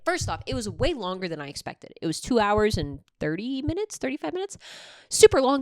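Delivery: ordinary talk, nothing marked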